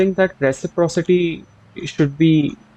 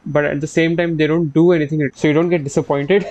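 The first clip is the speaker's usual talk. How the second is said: normal talking voice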